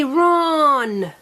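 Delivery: "run" drawn out long; said slowly. Shown as a demonstration. Teacher modelling slow before fast, drawn out. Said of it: "Say it fast".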